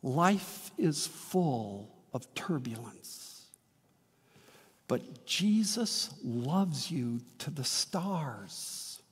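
soft, slow, hushed reassurance; tender and slow. Acted. Preacher gently reassures the congregation, slow and soft. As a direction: ease it out gently to the listener. Tender close after the story.

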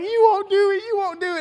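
high, teasing imitation of a wife; mock taunt. Laughing wife daring husband at the door, high taunt. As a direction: egg him on in a high mimicking voice.

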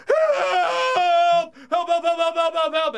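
high, repeated mock scream for help; panicked yelling. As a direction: re-enact a kid hollering down the street. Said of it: "just started yelling".

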